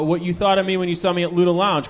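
usual speaking voice

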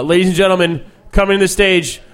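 booming emcee build-up to the name; grand entrance announcement. Real, not acted. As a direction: hype the crowd for the next act. Host introducing comic on stage.

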